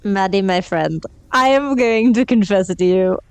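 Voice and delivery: ordinary talk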